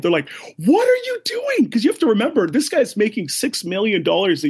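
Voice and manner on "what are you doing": very high, freaked-out shout; losing their minds. Retold or imitated. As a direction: shout it in shrieking, panicked disbelief. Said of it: "They lose their mind".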